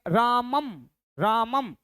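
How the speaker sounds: रामम with the dot read as a closing म; anusvara sound. Shown as a demonstration. Teacher shows students the dot read as म. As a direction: end रामम on a clear म sound. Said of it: "बिंदी लगा दिए तो क्या पढ़ेंगे".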